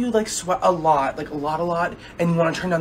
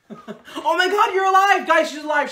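loud, high, hammed-up shout of relief with laughter; mock relieved shout; 9 semitones higher, 4 dB louder, slower than usual. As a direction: celebrate her survival loudly in jest. Mocking a friend who wheezed.

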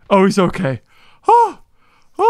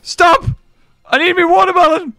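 high frantic pleading; panicked, breathless begging; panicking gamer; 8 semitones higher, 3 dB louder than usual. Real, not acted. Spiders attack, low health.

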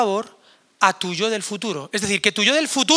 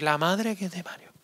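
exasperated future self; 2 semitones lower, 7 dB quieter than usual. Pretend. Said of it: "va a decir".

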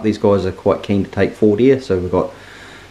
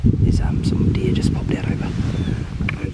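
slow hushed whisper; whisper, the deer are close; slower than usual. Real, not acted. Quietly spotting more deer.

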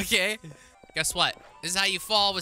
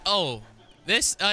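ordinary talk, nothing marked

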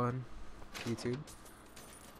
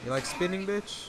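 taunting; 8 semitones higher than usual. Real, not acted. Taunting a spinning enemy.

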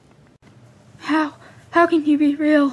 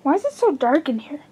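normal talking voice

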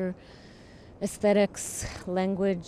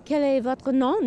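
French; 5 semitones higher, 3 dB louder than usual. Shown as a demonstration. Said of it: "Just in French".

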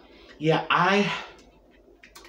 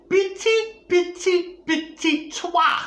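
high, slow mock French, petit and toi said playfully; slower than usual. Showing viewers her French, high and slow mock French. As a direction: show off fake French, high and stretched. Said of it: "That's my version of French".

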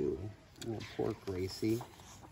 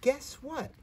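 excited. Acted. Excited kid shares news.

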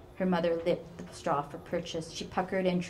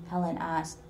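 ordinary delivery, nothing marked

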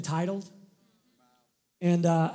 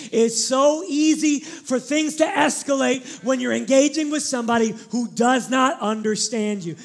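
preaching emphasis; 4 semitones higher, 6 dB louder than usual. Acted. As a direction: drive the point home. Preacher repeats point for emphasis.

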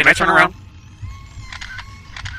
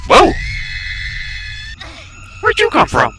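startled; 4 dB louder than usual. Real, not acted. Startled by sudden enemy.

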